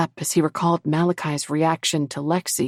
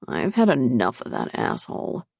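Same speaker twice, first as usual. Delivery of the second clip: low, sour grumble; grumbling; 4 semitones lower than usual. A narrator's reading. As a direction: grumble it under your breath. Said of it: "the smallest Lycan grumbled".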